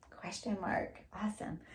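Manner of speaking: unvoiced whisper of praise to the class. Real, not acted. Teacher whispering the answer to the class. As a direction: breathe the words, no voice.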